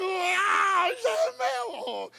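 high, slow ecstatic cry of faith; joyful cry. Pretend. Believer at the ATM, shouting his triumph. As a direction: shout it like it's already yours.

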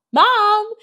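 high, loud call for Mum; child bursting in, calling. A narrator's reading. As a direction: call through the house to Mum. Sarah races in, calls mum.

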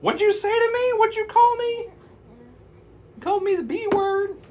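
very high, loud falsetto; high loud witch voice; offended witch. Acted. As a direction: squawk it like an offended cartoon witch. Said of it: "she said".

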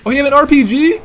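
high, loud incredulous question; loud surprise. Real, not acted. Player discovering a rocket launcher, loud surprise. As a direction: exclaim it in disbelief. Discovers opponent has RPG.

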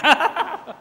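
a bright high burst of laughter; real chuckle; short laugh. Real, not acted. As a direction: laugh at your own joke. Laughs at own joke.

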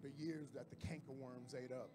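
soft, low, intimate sermon; hushed preaching. Acted. Pastor softly telling the church God's promise. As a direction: lean in and speak it gently.

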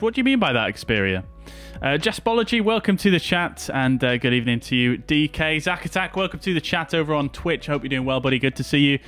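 ordinary delivery

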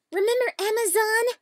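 high, loud outburst; mock-excited blurt. Real, not acted. Blooper taker blurting the wrong word, mock excitement.

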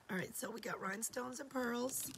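whispered through a lost voice; breathy whisper, voice gone. Real, not acted. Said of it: "I've lost my voice".

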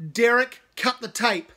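loud order to the engineer; loud announcement. Acted. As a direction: order it across the room. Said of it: "Cave announces".

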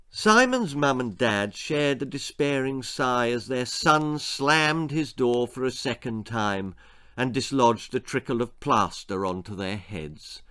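ordinary delivery

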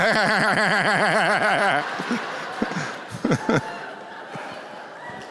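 helpless laughter at his own story; breaking into laughter mid-sermon; long real laugh. Real, not acted. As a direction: crack up laughing. Preacher cracking up at story.

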